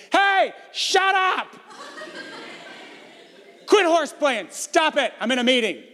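high, drawn-out holler that eases off; mom yelling. Retold or imitated. Mom in counseling hollers at her noisy kids, yelling. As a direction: yell down the hall at rowdy kids. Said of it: "You yell at your kids".

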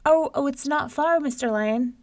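high, loud, hurried reassurance; placating Brer Rabbit. A narrator's reading. Rabbit soothes the impatient lion, nervously placating.

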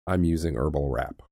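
normal talking voice

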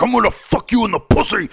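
loud, high mock yell of a cussing kid; distorted harsh kid. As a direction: imitate a kid yelling into an overloaded microphone. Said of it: "So it was distorting, and he's like".

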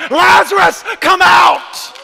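full shout, high and loud; triumphant commanding shout. Acted. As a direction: summon him out with all your voice. Jesus calls Lazarus again.

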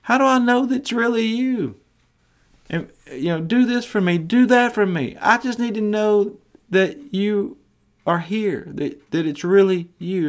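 high, pleading, mock-anxious voice; doubting believer. Believers pleading with Jesus for a sign, mildly anxious and high. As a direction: play the nervous doubter begging for proof. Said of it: "we're like".

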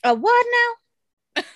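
high, loud "A what" in disbelief; a sharp jump up in pitch from shock; surprised. Real, not acted. Shocked by sea turtle claim.

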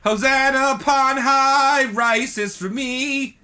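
high, slow churchy chant; mock holy proclamation. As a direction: proclaim it like a hammy preacher.

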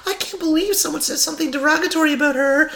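very high-pitched mocking impersonation; hammed-up high-voiced indignation, ridiculing her; mock outraged TV panelist. Host mimics her ranting on The View, mock outrage. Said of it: "she'll be on The View going".

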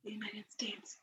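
whispered to herself; whispering. Real, not acted. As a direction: say it almost without voice. Thinking aloud during cheese quiz.

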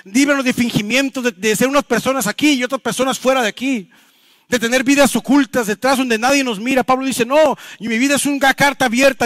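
high-pitched, driving sermon delivery; forceful preaching. Real, not acted. Pastor urging the congregation to live coherent lives, forceful preaching. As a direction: preach it hard with urgent conviction.

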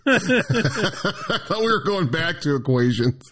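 high, slow words through laughter; laughing. Real, not acted. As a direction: laugh while you talk. Laughing at the joke.

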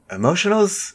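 high, rising "Emotionals?" in disbelief; baffled, high-pitched echo of a slip; puzzled self-query. Real, not acted. Queries own slip of tongue.

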